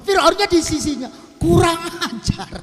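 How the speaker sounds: hammed-up fury of the overheard king; enraged Pharaoh. Pharaoh hears her curse him.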